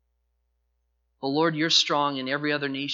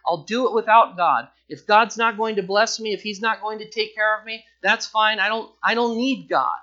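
louder and higher, rejecting God; defiant outburst; 5 semitones higher, 6 dB louder than usual. Retold or imitated. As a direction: spit it out in stubborn defiance. Voicing a defiant person.

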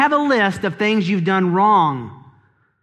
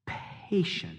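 whispered word; 3 semitones lower, 14 dB quieter than usual. Acted. Dropped to a whisper.

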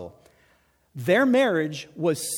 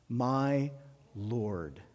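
lower, much softer "My Lord"; reverent Sarah; 6 semitones lower, 7 dB quieter than usual. Retold or imitated. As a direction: breathe it with deep respect.

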